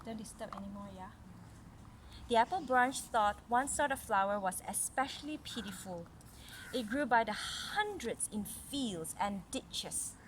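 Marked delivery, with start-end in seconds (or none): 2.3-10.1 clear, even read-aloud story voice; story narration. A narrator's reading. Mother reading a story to little Caris, storytelling voice. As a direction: read it like a picture book to a child.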